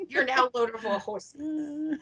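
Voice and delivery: laughing through the words; amused, laughing. Real, not acted. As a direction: giggle while you say it.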